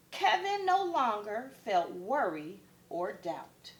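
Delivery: ordinary delivery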